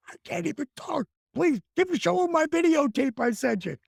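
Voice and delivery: high anxious plea; panicked pleading. Retold or imitated. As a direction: beg in a panic. Panicking to Mike on phone.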